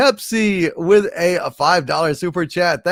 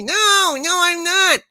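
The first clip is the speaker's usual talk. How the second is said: high squeaky denial; 13 semitones higher than usual. Acted. Denying being the father.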